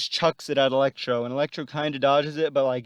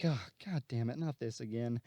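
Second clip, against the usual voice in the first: softer weary grumble; exasperated mutter; 3 semitones lower, 10 dB quieter than usual. Retold or imitated. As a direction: groan it under your breath. Spidey sees the fire again.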